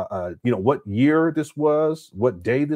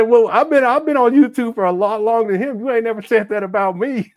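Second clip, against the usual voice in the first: higher and louder, jokingly offended; mock indignant outburst; 9 semitones higher, 6 dB louder than usual. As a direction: protest to your wife that you were on YouTube longer, loudly. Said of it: "I said".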